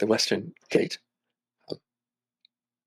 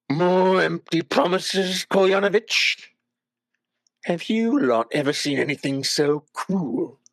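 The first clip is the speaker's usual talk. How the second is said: put-on slow, sneering, higher drunk's drawl; bitter old drunk; 7 semitones higher, slower than usual. The drunk in the corner mocking Ismark's promises. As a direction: drag each word out with contempt.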